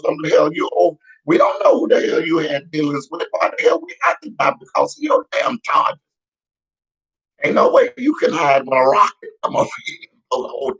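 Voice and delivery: heated outrage. Real, not acted. Ranting vlogger rails at the president over his debts, high and heated. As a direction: demand answers, voice climbing high.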